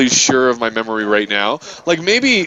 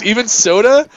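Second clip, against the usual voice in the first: mock incredulous question; 7 semitones higher than usual. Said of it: "She goes".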